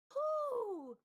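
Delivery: high loud owl hoot; shrill hooting owl call. Acted.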